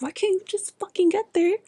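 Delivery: high-pitched, exasperated self-scolding; mad at self. Retold or imitated. Recalling scolding self over unreached goals, mock mad. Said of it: "I was getting mad".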